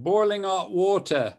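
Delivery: mimicking the interviewer's British accent. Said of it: "to use your dialect".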